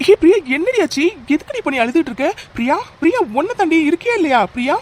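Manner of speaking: high-pitched anxious pleading for a reply; worried calling out. Acted. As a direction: urgently coax an answer from her. Calls to crying, silent caller. (பதறிப்போய் அழைத்து)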